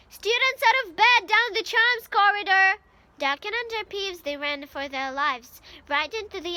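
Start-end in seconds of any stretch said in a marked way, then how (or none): 0.1-2.8 loud, higher bellowing alarm call; bellowed. A narrator's reading. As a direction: shout it down the corridor to give them away. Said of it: "Peeves bellowed".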